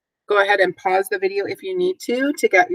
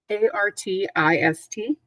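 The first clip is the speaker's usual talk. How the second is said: letters spelled one by one; 6 dB quieter than usual. Shown as a demonstration. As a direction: spell A R T I S T slowly for the class. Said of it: "spelling the word".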